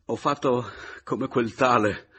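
loud, strained good cheer; forced smile. A narrator's reading. Narrator excusing himself, forcing a smile. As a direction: speak through a forced smile. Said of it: "ripresi sforzandomi a sorridere".

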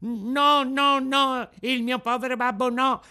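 high, loud desperate cry; cornered and desperate. Acted. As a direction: plead in panic for his father. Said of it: "gridò Pinocchio con accento disperato". (grido disperato e implorante)

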